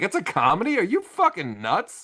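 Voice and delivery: high-pitched, retold disbelief; incredulous protest. Man retelling his reply to aunt who called it comedy, incredulous. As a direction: protest as if the very idea is insane.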